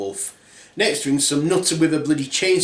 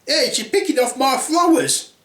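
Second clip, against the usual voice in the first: high-pitched mock outrage; indignant landowner; 11 semitones higher than usual. Landowner protesting at flower picking.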